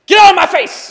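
angry person yelling at someone reasoning with them; angry shout. Retold or imitated. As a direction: bark someone away in rage. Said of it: "usually you're just like".

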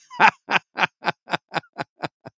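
loud high laugh at the comment; big laugh. Real, not acted. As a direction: burst out laughing. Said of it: "That's good stuff".

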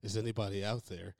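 low, haunting dispatcher call; a lightly voiced eerie dispatcher. Retelling the movie's opening to his cohost, a low haunting dispatcher voice. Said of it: "the most haunting dispatcher voice".

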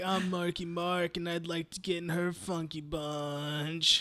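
mock [name]. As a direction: lay on the self-satisfied bro act.

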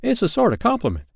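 quick, very high sidekick voice; bosun character. A narrator's reading. Smee consoling his captain, high quick bosun voice. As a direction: chirp it high and fast.

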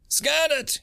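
short high barked command; barked order. A narrator's reading. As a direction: snap the order at the tech. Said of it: "he barked at the tech".

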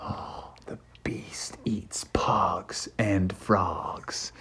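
very slow, hushed whisper; ominous whisper. A narrator's reading. Friends warn Froggy about the beast, ominous whisper. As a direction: whisper it slowly like a scary warning.

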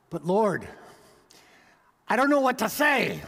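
a nervous believer pleads with his Lord; anxious excuse. Acted.

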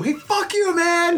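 very high-pitched mock outrage; indignant retort. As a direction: fire back as if deeply insulted, hammed up. Acts out touchy retort to teasing.

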